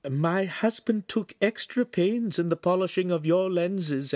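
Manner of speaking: high-pitched deferential lady; courteous Signora. Acted. Wife tells his excellency of her husband's work, high and courteous. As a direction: pitch it high, politely proud. Plays the Signora addressing the Podesta.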